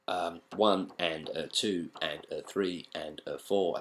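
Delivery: slow, even count of the beats; steady teacher's count-in. Shown as a demonstration. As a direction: count it out slowly, one beat at a time. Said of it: "that would be how they were counted".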